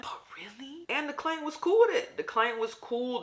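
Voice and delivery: a shrieking what-in-the-world rant about the reused blanket; outraged rant. Real, not acted. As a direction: let the indignation explode. Indignant rant about dirty blanket.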